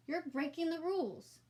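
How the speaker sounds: voce di bambino più acuta e severa; fratellino che rimprovera. A narrator's reading. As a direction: rimprovera la sorella con tono saccente. Brother scolds sister.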